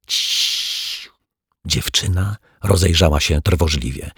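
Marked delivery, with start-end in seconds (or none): 0.1-1.1 breathy hushed shh; shushing whisper. A narrator's reading. Girl hushes the detective so children won't hear, whispered. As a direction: hush him quickly.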